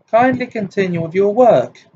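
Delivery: high and loud, brittle with hurt; icy command. A narrator's reading. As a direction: clip the order and turn away. Upset teacher dismisses class.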